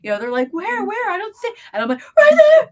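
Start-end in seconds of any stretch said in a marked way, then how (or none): 0.5-1.5 high, frantic mock search; frightened friends. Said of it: "the horror and fear". 2.2-2.7 high, loud terrified shriek; horror and fear. Retold or imitated. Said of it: "the horror and fear in my voice".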